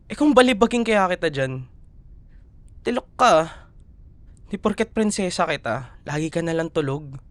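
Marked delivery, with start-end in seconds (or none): 0.1-3.5 louder, higher playful threat; hammed-up mock scolding. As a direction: pretend to threaten a sleepyhead. Playful threat to sleepy partner.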